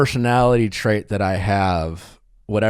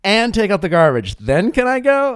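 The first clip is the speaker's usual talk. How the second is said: put-on higher pleading kid voice; negotiating child; 9 semitones higher, 4 dB louder than usual. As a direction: haggle like a persistent kid. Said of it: "I would negotiate".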